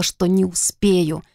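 plain reading voice

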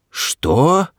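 high, rising cry of disbelief; flabbergasted question; stunned exclamation. A narrator's reading. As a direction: ask it stunned, pitch leaping up. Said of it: "воскликнул ошеломлённый Сларти Бартфаст".

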